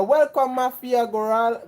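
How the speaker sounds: ordinary talk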